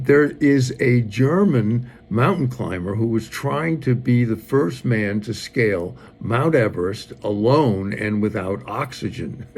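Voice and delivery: ordinary talk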